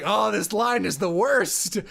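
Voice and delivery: slow, high, hammed-up groan; mock exasperation. Director and actress after many retakes, mock exasperation. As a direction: playfully curse a stubborn line.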